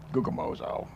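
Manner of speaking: "Gugamozo" muttered very low like grumbling; grumbling onomatopoeia. Shown as a demonstration. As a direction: grumble out "Gugamozo", deep in the voice, stressed on "mo". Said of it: "grumbling and complaining in the Greek are onomatopoeias".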